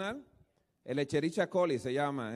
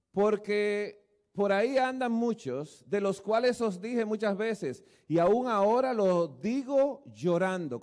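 solemn slow reading; 3 semitones higher, slower than usual. Acted. Reading Paul's tearful letter.

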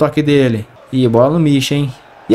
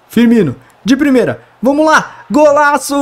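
explosive goal commentary; 11 semitones higher, 4 dB louder than usual. Acted. Play-by-play calling a goal.

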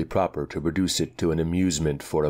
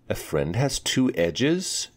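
higher questioning echo of the words; puzzled repeat; 6 semitones higher, slower than usual. Acted. Girl repeating a riddle back, higher and puzzled. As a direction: Anika echoes back, rising in puzzlement. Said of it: "she repeated".

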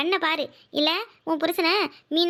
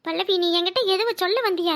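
normal talking voice

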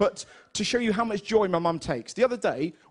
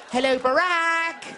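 slow, higher mock-posh phone greeting; mock mum on phone; 6 semitones higher, slower than usual. Mum greeting the President on the phone, slow and hammed. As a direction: ham up mum answering a famous caller. Mum's imagined phone call.

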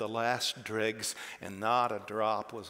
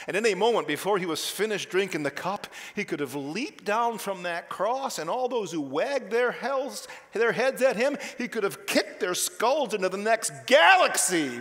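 louder and higher, hammering each phrase home; full-force preaching; 8 semitones higher, 7 dB louder than usual. Acted. Preacher to congregation, furious at the mockers, full-force preaching. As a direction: thunder it from the pulpit.

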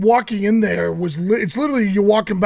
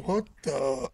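imitated stunned onlookers; 6 semitones lower, 10 dB quieter than usual. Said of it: "Imagine everybody just going".

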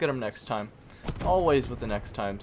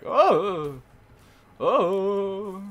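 normal talking voice

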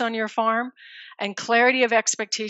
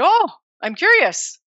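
mock innocent curiosity; 9 semitones higher, 6 dB louder than usual. Retold confrontation with father-in-law.

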